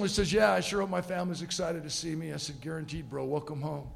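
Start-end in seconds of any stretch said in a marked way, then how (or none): 0.3-2.3 softer, slightly choked-up soldier; emotional. Retold or imitated. Soldier hoping family wants him home, mildly emotional. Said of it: "He gets emotional". 2.6-3.9 very soft, low and tender; quiet reassurance. Retold or imitated. Passenger welcoming soldier home, quietly reassuring.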